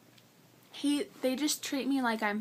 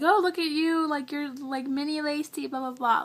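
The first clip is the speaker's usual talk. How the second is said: higher, louder gushing hello; gushing greeting; 4 semitones higher, 3 dB louder than usual. Retold or imitated. Receptionist greeting her at the clinic, gushing and higher. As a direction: imitate a delighted receptionist. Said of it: "she's like".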